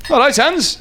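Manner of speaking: slightly hammed-up high call; diner summoning waiter. Diner snaps fingers at the waiter, high summoning call.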